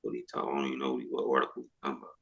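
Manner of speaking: ordinary talk, nothing marked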